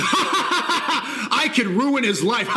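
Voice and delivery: mock him while laughing; mocking a rival's boast, laughing; laughing mock quote.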